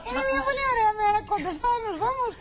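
the host copies her friend's telenovela voice; imitating the dubbing voice. Host mimics the guest's dubbing bit, hammed-up and high.

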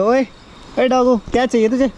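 cooing pet talk to the doggy. Real, not acted. Talking baby-talk to a street dog. (कुत्ते से तोतली प्यार भरी बोली)

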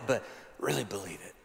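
soft sincere ending; hushed close. Acted. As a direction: drop to an intimate hush. Intimate close of point.

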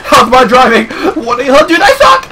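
laughing through a mock hotline joke. Real, not acted. Player laughing at his own crashes while driving. Joking after crashing cart.

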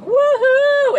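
very high, loud cheer; cheering woohoo. Retold or imitated. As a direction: whoop like a crowd at a gig. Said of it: "be like".

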